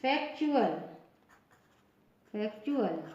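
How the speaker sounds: फैक्चुअल as "fek-chu-al"; T read as CH. Shown as a demonstration. Teacher modelling the UAL rule for learners, फैक्चुअल with "ch". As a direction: say फैक्चुअल with "ch" after the k. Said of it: "टी का उच्चारण च".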